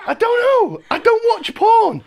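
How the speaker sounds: hammed-up defensive squeal; flustered denial. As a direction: protest too much in a squeaky pitch. Acted flustered denial, then admits.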